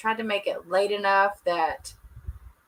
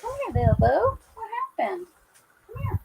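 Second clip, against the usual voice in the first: pet talk. Real, not acted. To her dog, coaxing it to say hi, high baby talk. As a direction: coax the dog sweetly, pitched way up.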